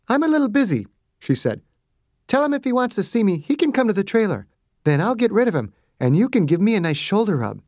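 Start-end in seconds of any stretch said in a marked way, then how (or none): 0.1-0.9 lifted, higher female voice, brushing him off; busy doctor waving off a request; Margaret's voice. A narrator's reading. 2.3-7.6 higher voiced woman doctor, playful; doctor teasing the guard about a shoulder rub; Margaret, teasing. A narrator's reading.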